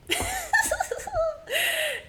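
high wheezing laugh, close to tears; high breathless laugh. Real, not acted. Recalling the high guy, laughing high and breathless. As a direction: laugh until it squeaks.

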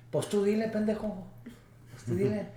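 a hint of a father snapping at his son; annoyed father. Father brushing off his son's request, mildly scolding.

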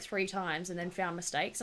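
ordinary talk, nothing marked

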